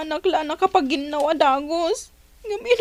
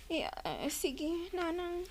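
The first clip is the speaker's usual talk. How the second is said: much softer, hesitant yes; barely answer, cowed; soft timid answer to the mother-in-law; meek reply; 15 dB quieter, much slower than usual. Acted. Caught idle, answering mother-in-law.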